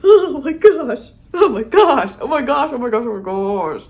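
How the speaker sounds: high, loud laughing squeals that fade to plain; a fan losing it, then catching her breath; giddy squeal, fading. Real, not acted. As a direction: squeal with delight and calm down over the repeats. Squealing over a dance scene.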